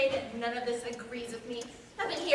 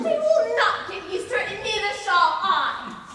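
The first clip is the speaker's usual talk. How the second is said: wife shouting back at her husband, slowed and loud; defiant shout; 6 semitones higher, 11 dB louder than usual. Acted. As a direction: hurl it back at him.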